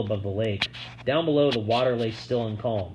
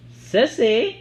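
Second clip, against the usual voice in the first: puzzled woman; 12 semitones higher, 7 dB louder than usual. A narrator's reading.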